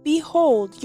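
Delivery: usual speaking voice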